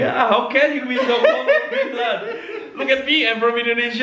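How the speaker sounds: high, laughing tease; laughing teasingly. Real, not acted. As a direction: laugh through the teasing words. Teasing friend about being cold.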